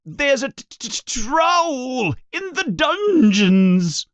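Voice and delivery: high, stuttering, panicked cry; terrified stammering yell. Acted. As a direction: stammer out the warning in terror. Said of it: "and yells".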